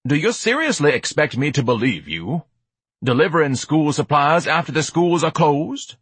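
grand, scornful questioning; incredulous. Acted. Ignatius doubts the school delivery story, incredulous.

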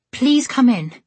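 high, loud, overdone welcome; overdramatic. Acted. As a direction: gush it theatrically. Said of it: "with an overdramatic gesture".

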